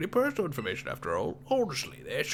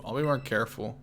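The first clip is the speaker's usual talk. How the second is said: ordinary talk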